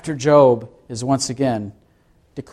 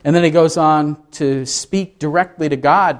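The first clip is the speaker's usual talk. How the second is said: normal talking voice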